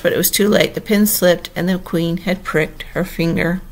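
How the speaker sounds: plain reading voice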